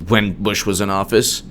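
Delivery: ordinary delivery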